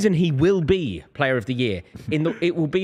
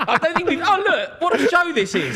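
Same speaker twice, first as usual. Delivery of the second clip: laughing; 13 semitones higher than usual. Real, not acted. Laughing at camera antics.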